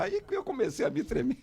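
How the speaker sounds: ordinary talk, nothing marked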